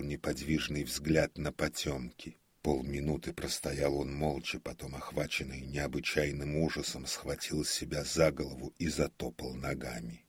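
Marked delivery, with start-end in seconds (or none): none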